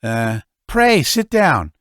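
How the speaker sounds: put-on fussy old father. Father welcomes son.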